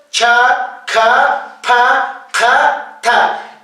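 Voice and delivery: "Cha, ka, pa, ka, ta" each with a puff of air; aspirated consonants. Shown as a demonstration. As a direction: breathe out hard after each consonant in "Cha, ka, pa, ka, ta". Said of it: "consonantes aspiradas". (consonantes aspiradas, con soplo de aire)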